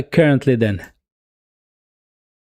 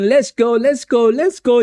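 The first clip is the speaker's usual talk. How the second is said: high, eager rallying cries; excited cheering; 7 semitones higher than usual. Real, not acted. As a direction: egg the team on, pitch up high. Urging team forward.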